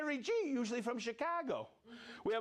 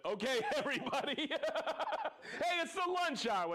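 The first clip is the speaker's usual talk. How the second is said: high, giggly words through laughter; laughing; 6 semitones higher, faster than usual. Real, not acted. Host rallying everybody at lunch hour, laughing hard. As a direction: talk while cracking up, pitch climbing. Host cracking up mid sign-off.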